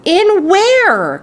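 put-on boy crying out to his grandfather in suspense; eager cry. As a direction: blurt it out, dying to know. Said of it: "cried Charlie".

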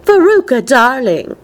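high, lilting society mother; cooing mother. A narrator's reading. Missus Salt addresses her daughter, cooing. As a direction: sing out the pet name from up high.

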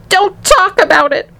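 high-pitched distraught mother; acted high wail. A narrator's reading. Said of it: "wailed Missus T V".

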